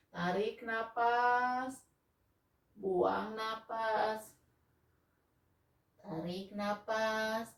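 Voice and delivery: slow, calm yoga breathing count; slow breathing cues. Shown as a demonstration. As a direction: draw each cue out like a breath. Slow cat cow breathing cues.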